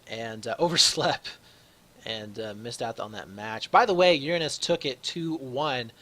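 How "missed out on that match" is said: normal talking voice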